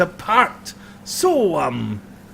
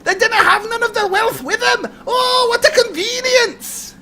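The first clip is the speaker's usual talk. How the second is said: shrieking wild woman. Acted. Scruffy mother crows to Tom about no wealth, high gleeful shriek. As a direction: shriek it gleefully through a laugh.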